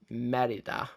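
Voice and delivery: Merida with a tapped Scottish R; poor attempt at saying Merida in a Scottish accent. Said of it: "my poor attempt at obviously doing the accent".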